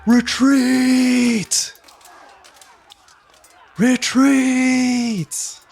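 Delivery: long, drawn-out high battle cry; commanding, full-voiced sustained shout; bellowing command shout. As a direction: bellow the order across a battlefield. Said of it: "with that bellowing, commanding voice shout".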